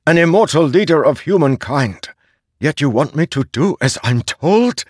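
defiant servant building to an outburst; rising defiance. A narrator's reading. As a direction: refuse to be a tool, growing heated. Szeth defying the herald.

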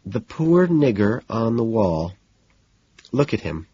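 slow, uncertain reading aloud; hesitant reading. Acted. Woman reading his disturbing poem aloud, slowly, hesitantly. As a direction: read haltingly, unsure of every word. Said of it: "hesitantly begins reading, slowly, stopping often".

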